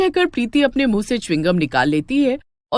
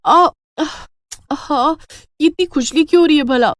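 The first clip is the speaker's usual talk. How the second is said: squirm from the itching; itchy distress; 6 semitones higher than usual. Acted. Itching scalp. (खुजली से बेचैन ऊँची आवाज़)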